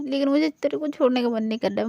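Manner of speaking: ordinary delivery, nothing marked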